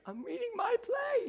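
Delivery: very high, giddy reply; giddy squeal. Retold or imitated. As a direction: squeal it like a thrilled child. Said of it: "I said".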